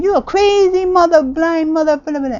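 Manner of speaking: high, loud mock heckling; hammed-up shouted insults; imitated angry yelling. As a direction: yell like an angry bystander, tailing into blah-blah. Imitates people yelling in background.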